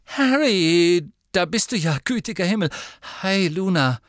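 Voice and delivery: high, loud, relieved hello; flustered greeting. Acted. Hermine escaping to Harry and Luna, flustered greeting. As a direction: greet them in a high, excited rush.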